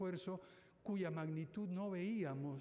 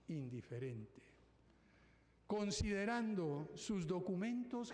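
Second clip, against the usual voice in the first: poem recitation. Shown as a demonstration. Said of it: "Quisiera compartir con ustedes las últimas líneas de mi poema favorito".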